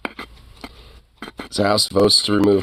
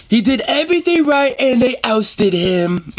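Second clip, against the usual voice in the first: very high, slow put-on wail; mock outcry. Streamer parodying a reaction to McCarthy's ousting, mock outcry. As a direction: draw out a high, hammed-up lament.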